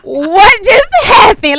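slow, high, loud and stunned; stunned disbelief. Retold or imitated. Telling friends how the conversation floored her, stunned. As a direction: let each word hang in shock.

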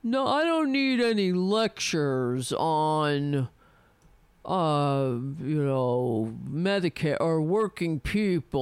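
slowed, lightly put-on smug senator; mocking Bennet. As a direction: lightly parody a dismissive politician. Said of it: "And he was like".

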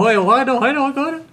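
rising, jeering mimicry; mocking. Making fun of friend's "hot dog", high mocking repeat. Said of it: "I made fun of him incessantly".

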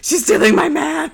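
jealous outrage. Acted. Fifi jealous of Belle stealing her man, mock outrage. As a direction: exclaim like a wronged girlfriend.

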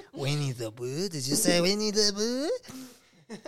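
put-on French accent, rising at the end. Shown as a demonstration. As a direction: ask it in a mock French accent. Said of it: "I do my French accent".

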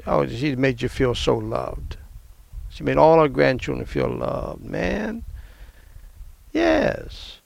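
ordinary talk

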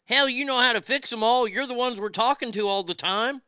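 normal talking voice

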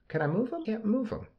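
high squeaky cartoon sidekick; minion voice. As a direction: chirp it like a little cartoon minion. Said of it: "You sounded like a minion".

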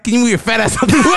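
retold request cracking up into laughter; laughing punchline. Punchline told through rising laughter.

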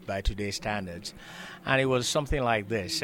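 normal talking voice

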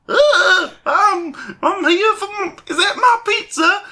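squeaky high dopey voice; high silly voice. Retold or imitated. Retelling how Mister Crow asked about his pizza, high and squeaky. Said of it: "then he was like".

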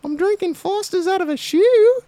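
mock posh wife in a high falsetto. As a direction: squeak it out like a prim lady. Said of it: "do a female voice".

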